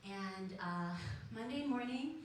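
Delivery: ordinary delivery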